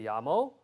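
"llamo" with a Y sound, like "yamo"; Y pronunciation. Shown as a demonstration. Preacher showing how you'd read it, "llamo" with a Y. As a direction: say "llamo" opening on a Y glide. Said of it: "with a Y".

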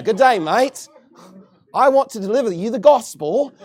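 hammed-up mock Australian accent. Preacher imitating a foreign soul-winner, mock Australian accent. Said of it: "they like an Australian accent".

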